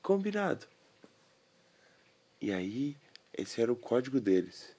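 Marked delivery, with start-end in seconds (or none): 0.0-0.7 high pony voice; squeaky pony agreeing; talking pony. Acted.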